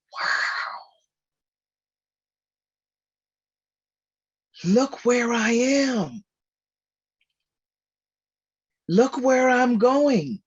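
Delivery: slow, awed wow; genuine wonder. Retold or imitated. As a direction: marvel at it, taking your time. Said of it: "I might just go".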